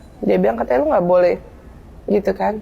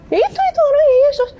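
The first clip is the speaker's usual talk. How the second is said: very high-pitched retold bickering; heated bickering; 11 semitones higher than usual. Arguing over two different pictures of Jesus, heated bickering. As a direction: squabble in a raised pitch, starting fast.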